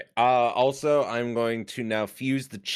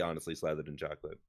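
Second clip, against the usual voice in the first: quick soft murmur; 9 semitones lower, 12 dB quieter, much faster than usual. Pretend. Fast low chocolate murmur.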